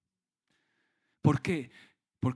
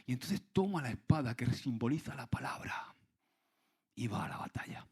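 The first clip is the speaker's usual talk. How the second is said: whispering; 3 semitones lower, 4 dB quieter than usual. Real, not acted. Dramatic sermon point, whispered.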